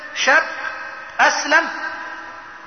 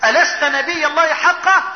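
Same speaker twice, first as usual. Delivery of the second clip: raised-pitch, urgent question; distressed challenge; 4 semitones higher, 3 dB louder than usual. Retold or imitated. Umar unable to bear the treaty, distressed challenge. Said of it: "مش قادر يتحمل".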